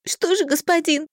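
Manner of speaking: put-on high, quavering girl; trembling voice. A narrator's reading. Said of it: "дрожащим голосом произнесла я".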